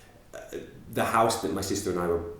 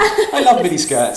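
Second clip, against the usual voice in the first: creepy dad voice; 10 semitones higher, 11 dB louder, slower than usual. Pretend. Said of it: "Said the creepy dad".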